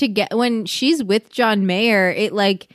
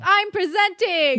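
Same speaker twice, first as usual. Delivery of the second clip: corny villain; 8 semitones higher than usual. Retold or imitated. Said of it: "when he's just like".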